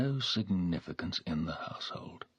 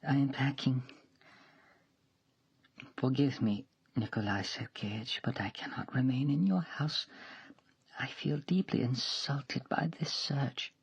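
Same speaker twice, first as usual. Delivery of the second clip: put-on higher, whispered in places, an offended young woman; hurt young maid; 8 semitones higher than usual. A narrator's reading. Mashenka voiced, hurt and leaving.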